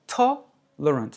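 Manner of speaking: "tolerant" broken slowly after a loud TOL; loud TOL, pause, then reduced "lerant" in "tolerant"; English "tolerant" slowed to show the strong TOL; stress on first syllable. Shown as a demonstration. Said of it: "L'accent sur la première".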